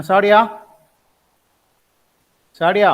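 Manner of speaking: loud call for attention. Real, not acted. Teacher calls silent student online.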